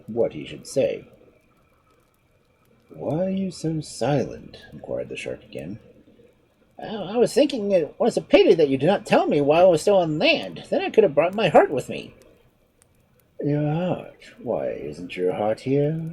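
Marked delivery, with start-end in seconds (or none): 2.9-4.6 slow, heavy shark voice. Acted. Shark asks. 6.8-12.1 higher, lighter voice for the sly monkey; clever monkey. Acted. Monkey explains. 13.4-16.0 slow, puzzled shark; slow and baffled, like a dull shark. Acted. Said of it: "with a puzzled expression".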